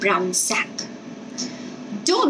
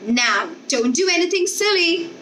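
worried warning; 8 semitones higher than usual. A narrator's reading. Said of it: "Missus Fuller said".

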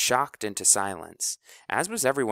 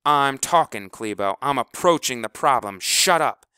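much louder, sharp angry order; commanding; 4 semitones higher, 9 dB louder than usual. Acted. House orders Kleebo to shut up, loud and angry. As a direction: shut him down hard.

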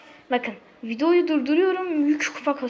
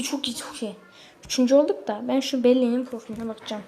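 ordinary talk

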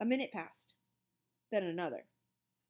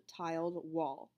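usual speaking voice

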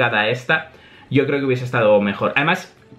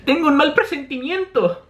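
high-pitched character voice; uneasy girl, pitched way up; Nami; 13 semitones higher than usual. Acted. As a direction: voice a worried anime heroine. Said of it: "dice Nami".

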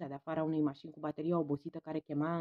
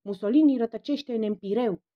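loud, stern command; authoritative call for silence; 4 semitones higher, 9 dB louder than usual. A narrator's reading. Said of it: "Paulian intervenia autoritar".

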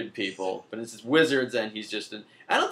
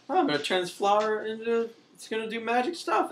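put-on high excited little girl; 10 semitones higher than usual. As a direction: play a giddy little kid. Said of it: "one of them is like".

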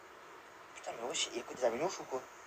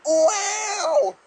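astonished wow; 14 semitones higher, 7 dB louder than usual. Real, not acted. Amazed reaction in game.

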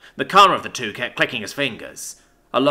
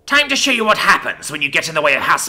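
higher, louder, menacing challenge; loud threat; 4 semitones higher, 7 dB louder than usual. A narrator's reading. Prefect threatens those crossing the House, loud threat. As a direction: throw down a threat for all to hear.

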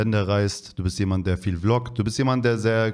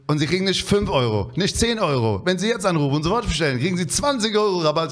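hyped TV shopping announcer pitch; infomercial; 7 semitones higher than usual. Retold or imitated. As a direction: pitch it like a teleshopping host, faster. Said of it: "kennst du diese, äh, Fernsehsendung früher".

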